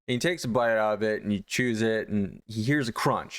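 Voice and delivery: ordinary talk, nothing marked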